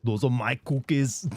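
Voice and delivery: slow Austrian Arnold impression; slow Austrian accent, insisting. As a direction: lean into the Austrian vowels. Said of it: "my Arnold".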